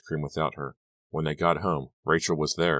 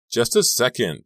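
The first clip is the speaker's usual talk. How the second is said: higher and louder call; 7 semitones higher, 6 dB louder than usual. A narrator's reading. Dawn to Robbie below, calling out. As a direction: call it down to the stage. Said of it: "Dawn called down".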